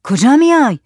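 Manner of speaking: high, loud warning question; shouted challenge. Acted. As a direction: shout to halt someone approaching. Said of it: "زکریا داد زد". (داد زدن هشدارآمیز)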